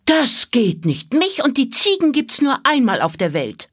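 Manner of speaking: put-on high, outraged child's protest; a little girl insisting she is one of a kind; indignant girl. A narrator's reading. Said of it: "Da rief Mira empört".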